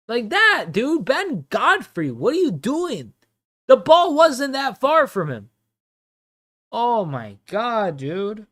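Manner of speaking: real frustrated outburst; exasperated yell. Real, not acted. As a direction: shout at the screen in frustration. Yelling at Ben Godfrey's mistake.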